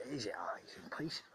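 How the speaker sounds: he's in pieces whispered, voiceless. Real, not acted. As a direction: drop to a hushed whisper. Repeats line, then whispers.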